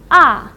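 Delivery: 啊 short, loud and falling sharply; fourth tone. Shown as a demonstration. Teacher reads the tones one by one, falling fourth tone. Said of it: "read the four tones one by one".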